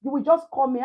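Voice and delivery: ordinary talk, nothing marked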